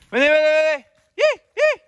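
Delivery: high, loud sing-song call; calling the puppies. Real, not acted. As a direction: call the pups to follow.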